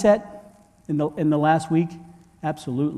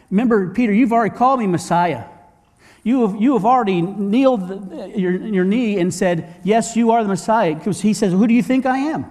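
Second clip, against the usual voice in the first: preaching at full force; 5 semitones higher, 4 dB louder than usual. Acted. Preacher presses Peter, raised.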